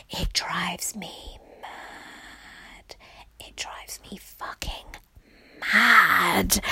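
exasperated whisper. Real, not acted. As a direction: whisper it through frustration. Exasperated aside.

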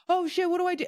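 high-pitched imitation freak-out; mock panic. Coach mimicking people who mess up and freak out, mock panic. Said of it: "And then they freak out".

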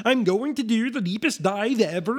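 put-on Swedish Chef accent. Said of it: "he really does sound like the Swedish chef".

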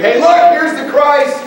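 high, loud, acted announcement; excited calling out. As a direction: call the crowd over to see. Acts out people announcing the Christ.

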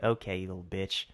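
mock sorry that is really an insult, under the voice; insincere apology. Barista after tapping the man's shoulder, low insincere apology. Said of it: "it wasn't a sorry".

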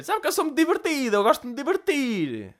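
put-on high old lady voice, slowing down; squeaky granny showing off her fun side; high mock-granny boast; old woman voice. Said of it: "uma velha desdentada".

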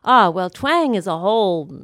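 usual speaking voice

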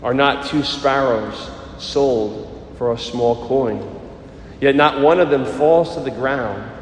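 solemn liturgical proclamation; Gospel reading. Acted. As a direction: proclaim it like scripture at Mass.

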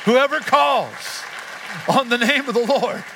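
loud, high, slow preaching build; full-force preaching. Acted. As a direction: preach it out with rising fire. Preaching climax.

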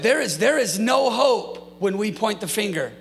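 impassioned pastor crying out to the church; fiery preaching. Acted. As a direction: preach it out with full force. Preaching builds to full force.

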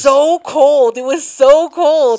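drawn-out, loud stress on "so cold"; emphatic complaint. Real, not acted. Reliving painfully cold chugged slushy drink.

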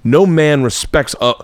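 mock macho dad lecture, rising in pitch; imitated stern dad. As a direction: berate a son as his father, word by word. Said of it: "my dad would say".

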